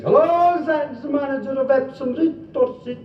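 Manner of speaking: high-pitched comic caller, held throughout; put-on high telephone voice; phone caller. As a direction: pitch the whole enquiry way up. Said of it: "the guy phones up and he says".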